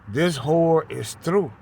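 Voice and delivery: loud, high preaching cry; booming sermon proclamation; preaching at full force. Acted. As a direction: cry it out like a street prophet.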